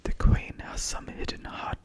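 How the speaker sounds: put-on a clumsy British accent that falls apart. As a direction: try a British accent on the Queen line and fumble it. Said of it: "maybe I'll do this in a British accent".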